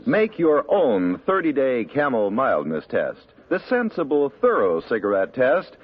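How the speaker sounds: slow, selling announcer voice; radio ad read. Acted. Announcer selling Camels to listeners, slow ad read. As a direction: pitch the cigarettes with measured polish.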